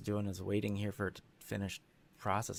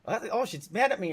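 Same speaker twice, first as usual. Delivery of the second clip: higher and louder, playfully imitating his own worry; mock worried voice; 9 semitones higher, 6 dB louder than usual. Recalling how he used to worry she was angry, higher mock fretting. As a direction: fret aloud in a hammed-up panic. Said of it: "I'd be like".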